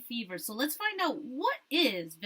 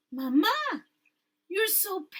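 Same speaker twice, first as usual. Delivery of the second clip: worried daughter; 9 semitones higher, 6 dB louder, slower than usual. Acted. Daughter worried about pale mother.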